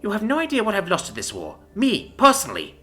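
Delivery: feral fury. A narrator's reading. Commander rages at the envoy over her losses, furious. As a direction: rage at everything the war took. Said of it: "The feral woman continues".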